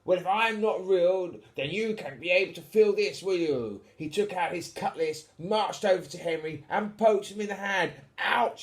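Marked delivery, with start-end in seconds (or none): 0.0-3.8 pirate captain. Acted. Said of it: "pirate voice". 8.2-8.6 a high, loud yelp of pain; child yelping "Ouch!"; shouted ouch. Acted. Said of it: "shouted Henry".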